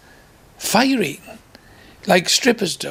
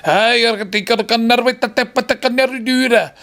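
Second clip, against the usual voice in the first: higher mock Glasgow accent; 6 semitones higher than usual. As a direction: put on a Glaswegian magician's patter. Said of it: "apparently he was from Glasgow".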